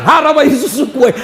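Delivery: imitating an angry mum, half laughing; mother's outburst. Preacher imitating his mother's final threat, a loud laughing outburst. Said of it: "Langsung dia bilang".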